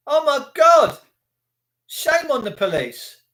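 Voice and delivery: usual speaking voice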